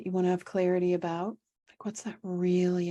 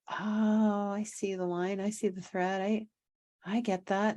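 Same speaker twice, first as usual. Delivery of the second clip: higher-pitched mock "aha" moment; dawning realization; 4 semitones higher, slower than usual. Guides and teams finally seeing the thread, dawning realization. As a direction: voice a team gently catching on.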